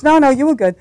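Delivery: a hint of a dismissive mom; lightly voiced mother, no no; mock mother. Mother answering the baby.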